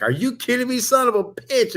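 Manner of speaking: mock outrage. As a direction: squeal it up like you can't believe it. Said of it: "I'm like".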